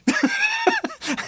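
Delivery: sudden high burst of laughter. Real, not acted. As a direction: laugh in delight. Laughs at the baker story.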